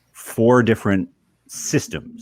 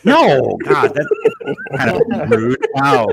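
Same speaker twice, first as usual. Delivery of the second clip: mock offended; 11 semitones higher, 6 dB louder than usual. Teased about kids leaving him.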